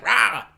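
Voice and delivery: short imitated lion roar. Lion answering the T-Rex, imitated roar. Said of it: "and the lion's like".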